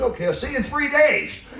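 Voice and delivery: eager and laughing; bursting out with glee. Retold or imitated. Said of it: "I said".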